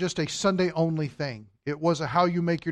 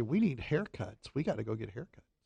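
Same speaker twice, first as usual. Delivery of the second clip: prompted line; 3 semitones lower, 8 dB quieter than usual. Pretend.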